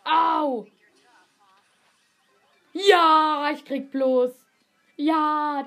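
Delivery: loud, high yelps under fire; panicking gamer. Real, not acted. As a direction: yelp as you take hits. Getting hit mid-fight.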